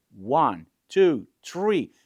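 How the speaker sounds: loud, separated count; counting down aloud. Shown as a demonstration. Photographer cueing the model to move, counting down aloud. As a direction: call out each number as a cue.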